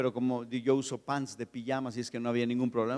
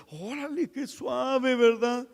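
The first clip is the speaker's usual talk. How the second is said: delighted; 10 semitones higher, slower than usual. Retold or imitated. Delighted by the earthquake.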